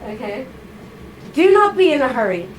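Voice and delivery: ordinary talk, nothing marked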